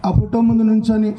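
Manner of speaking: ordinary talk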